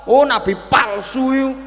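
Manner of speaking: high, loud scornful jab; angry insult. Retold or imitated. Old man furiously calling Musa a fake prophet. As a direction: spit the accusation out loud. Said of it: "Marah-marah dia".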